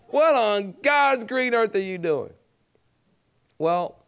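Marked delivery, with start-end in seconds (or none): 0.1-2.3 high, slow grandma's scolding; a lighter replay of the scolding granny; repeating grandmother's line for the audience, slow and high; echo of grandmother. Retold or imitated. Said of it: "I was born with this stuff".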